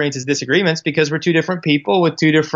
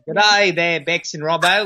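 mock Australian accent; 2 semitones higher, slower than usual. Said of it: "I can do other accents, but Australian".

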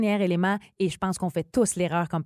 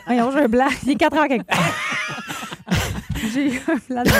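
laughing; 5 semitones higher, 3 dB louder, faster than usual. Real, not acted. Blanks out, laughs.

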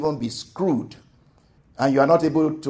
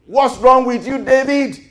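angry crowd; 10 semitones higher, 5 dB louder than usual. Retold or imitated. Angry men confront David.

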